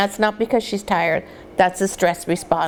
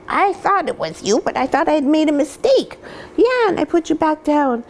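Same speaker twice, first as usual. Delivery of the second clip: puppy talk; 7 semitones higher than usual. Real, not acted. Talking to the puppy.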